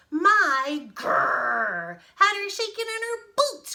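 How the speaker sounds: loud, high, slow boast; boastful bear at full voice; growling bear. Acted. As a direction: growl out the GRRR. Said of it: "growled the bear".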